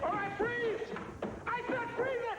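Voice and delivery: very high, frantic freeze order; wildly high-pitched shout; shrieked command. Acted. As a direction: screech it like a panicked cop. Holding gunmen at gunpoint.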